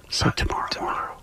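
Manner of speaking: hushed secret-telling whisper; conspiratorial whisper. Pretend. Radio host teasing tomorrow's announcement, whispering. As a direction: whisper it like classified news. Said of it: "Why are you whispering?".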